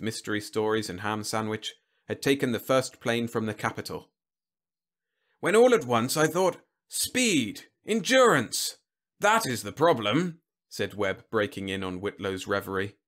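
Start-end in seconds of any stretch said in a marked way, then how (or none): 5.4-10.4 loud, high-pitched orating general; blustering general. Acted. General lecturing the Secretary, emphatic and blustering. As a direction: hold forth like an excited commander.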